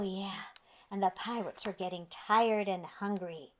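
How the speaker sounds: plain reading voice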